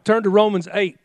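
usual speaking voice

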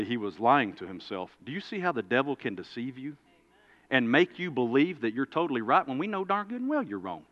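ordinary talk

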